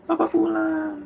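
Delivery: put-on high, gleeful little child cheering; child's cheer, high-pitched; excited child voice. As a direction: squeal it like a happy kid greeting dad. Child cheering in sermon story.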